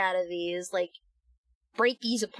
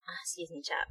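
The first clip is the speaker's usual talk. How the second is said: stuffy, dust-tickled voice; coughing on dust; 4 semitones lower, 11 dB quieter than usual. Real, not acted. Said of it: "the dust is getting to me".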